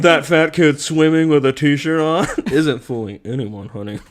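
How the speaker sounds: sassy drag queen, loud then dropping on "honey". Shown as a demonstration. Player showing a friend drag queen, loud sassy voice. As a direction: throw shade like a drag queen. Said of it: "you can't do drag queen?".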